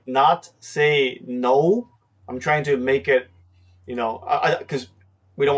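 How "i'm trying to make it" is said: ordinary delivery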